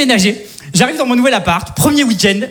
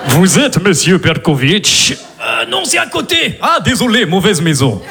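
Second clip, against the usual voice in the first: put-on Russian cop accent; slower than usual. Russian accent for a cop film entrance. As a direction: play a Russian movie cop barging in. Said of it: "une entrée genre film de flic russe".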